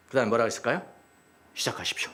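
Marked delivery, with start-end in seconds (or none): 1.5-2.1 soft, near-whispered invitation; acted humble hush; hushed deference. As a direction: quietly hand it over to a master. Acted disciple humbly deferring to Lord.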